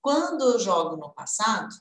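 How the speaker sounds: ordinary talk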